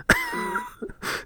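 squeaky high-pitched giggle; a short high burst of giggling. Real, not acted. Laughing at the sitcom moment.

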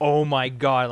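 loud retold exclamation of shock; stunned disbelief. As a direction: call it out, reeling from the news. Repeats retold reeling reaction.

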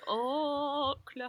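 "Oklahoma" with a long drawn-out opening O; trial pronunciation. Shown as a demonstration. Asking how to sing the show's O, "Oklahoma" drawn out. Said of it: "is it meant to be like".

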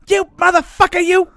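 high, loud Joe Pesci-style raccoon snarl; shrieked tough-guy raccoon insult; Rocket Raccoon voice. Retold or imitated. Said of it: "cited Tommy DeVito ... as an influence for Rocket Raccoon's voice".

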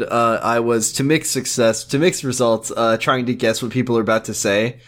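usual speaking voice

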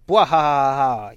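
loud booming bwah-ha-ha villain laugh; evil villain laugh. Acted. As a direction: belt out a big hammy evil cackle. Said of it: "she's fully playing into it".

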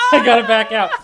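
normal talking voice

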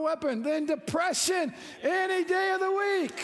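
high, loud joyful preaching; fired-up preaching. Acted. Pastor rallying the church, fired-up preaching. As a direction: lift the room with joy. Excited preaching at full force.

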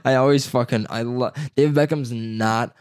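ordinary talk

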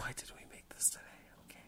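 breathy unvoiced whisper. Real, not acted. Asks her co-host in a whisper why they made this. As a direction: hush it to yourself.

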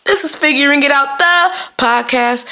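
high, drawn-out show intro; podcast host voice. Shown as a demonstration. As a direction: announce the show with a put-on lift. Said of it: "I gotta put that voice on".

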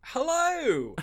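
squeaky high greeting; high silly hello. Acted.

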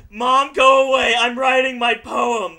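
put-on loud, slow teen yell; yelling across the room. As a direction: yell back at mom from your room.

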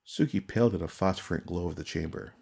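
usual speaking voice

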